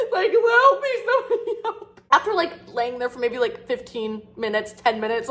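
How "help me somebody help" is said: high, laughing cry for help; mock helpless wail. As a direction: ham up a pitiful plea, giggling. Mock self-pity while crying.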